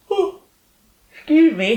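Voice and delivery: yawning through words; stifle a yawn. Real, not acted. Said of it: "he didn't yawn".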